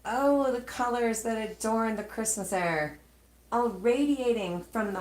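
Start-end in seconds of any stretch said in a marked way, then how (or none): none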